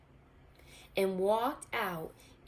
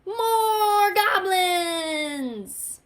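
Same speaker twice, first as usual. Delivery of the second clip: cheer; 11 semitones higher, 9 dB louder, much slower than usual. Acted. Goblins' triumphant cheer.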